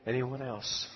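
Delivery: soft, low-key pastoral invitation; hushed closing appeal to the congregation; gentle altar call. Real, not acted. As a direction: invite them forward quietly, with long pauses.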